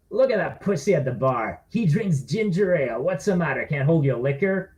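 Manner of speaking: taunting bar bully; jeering at the man at the bar; mocking drunk. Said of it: "one of them said".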